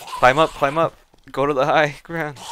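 being chased. Real, not acted. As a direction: shout orders to escape upward. Fleeing a zombie horde.

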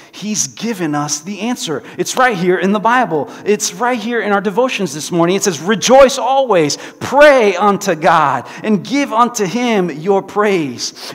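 loud, high, eager reading; bursting with the good news; excited. A narrator's reading. Betsy telling Corrie the Bible answer, excited. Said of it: "she said excitedly".

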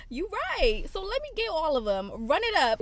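high, swooping retold surrender; playful high-pitched go-ahead; gleeful giving in. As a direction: act out caving happily to temptation. Acts out giving in at till.

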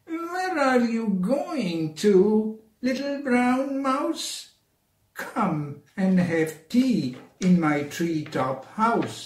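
high hooting owl asking the mouse. Acted. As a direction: sing it out like a wise old owl. Owl voiced high and slow.

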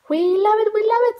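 put-on high, eager children's cheer; excited kids. Children begging the babysitter, high and eager. Kids beg for more rhymes.

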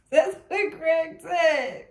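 high, loud and drawn out; helplessly amused high holler; laughing shriek. Real, not acted. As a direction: squeal it out slowly through laughter. Still cracking up at Craig.

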